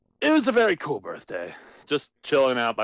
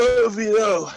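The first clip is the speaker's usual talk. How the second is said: mock Stewie; 7 semitones higher, 4 dB louder than usual. Caller doing Stewie voice.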